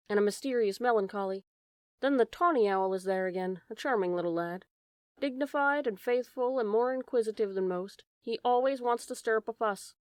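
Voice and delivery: ordinary delivery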